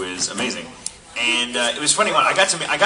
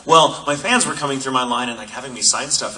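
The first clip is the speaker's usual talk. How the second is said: usual speaking voice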